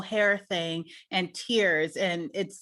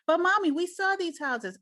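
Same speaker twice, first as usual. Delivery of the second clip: voicing daughter; 8 semitones higher than usual. Daughter insisting.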